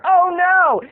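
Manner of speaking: high, loud Oh no; hammed-up dismayed gasp of a line; mock dismay.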